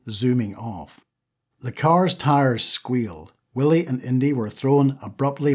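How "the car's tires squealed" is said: usual speaking voice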